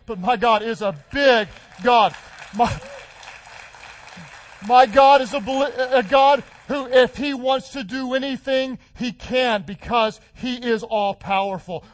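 loud rising sermon cadence; preaching at full force. Acted. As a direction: build it like a revival preacher. Preacher builds to climax.

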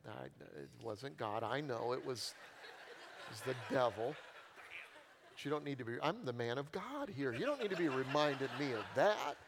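usual speaking voice

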